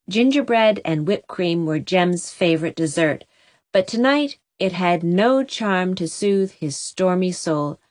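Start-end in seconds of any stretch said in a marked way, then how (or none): none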